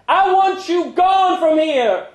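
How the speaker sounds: very high, loud, slow yell; furious shout. Retold or imitated. Brenda ordering Larry gone, furious slow shout. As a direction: drive him out the door.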